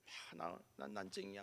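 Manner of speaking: very soft, deflated self-pity; imitated dejection. Teased boy taking the insult to heart, mock dejected. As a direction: say it feebly, as if crushed. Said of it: "막 이러는 게 아니라".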